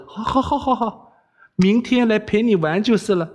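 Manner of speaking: high, hasty placating yeses; reluctant giving in. Acted. Wei Xiaobao yielding to the princess's threat, resigned. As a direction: give in with a high, harried voice.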